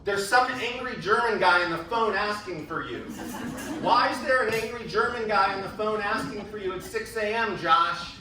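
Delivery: loud, high yell calling Josh down the hall. Retold or imitated. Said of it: "my roommate was yelling down the hall".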